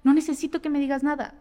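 ordinary talk, nothing marked

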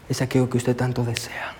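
whispered key phrase. Real, not acted. Preacher whispers the point to the congregation, hushed. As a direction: breathe the words out.

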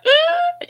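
sudden very high emotional cry; cry of release. Real, not acted. Mother reliving her daughter's words, crying out with emotion. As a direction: let out everything at once. Something in me just broke.

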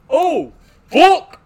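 very high, very loud mock shout; yell from the back. Boy in the back corner yelling at the bird, loud high mock shout. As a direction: belt it out like a kid in the back corner. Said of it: "just yells like".

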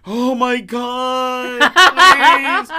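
slow drawn-out moaning; dramatic sick man. Retold or imitated. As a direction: whine about a runny nose. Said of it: "Willie gets sick".

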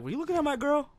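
high-pitched confrontational question; put-on high accusing voice; mock jealous guy. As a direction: imitate a jealous boyfriend.

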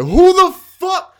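high-pitched, strained mock rant; retold blow-up. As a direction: act out losing it, pitched sky-high. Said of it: "I lost my shit".

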